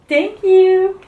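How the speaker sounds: high, loud, pleased praise; high pet praise; praising dog. Real, not acted. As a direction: reward the dog sweetly. Dog gives a shake.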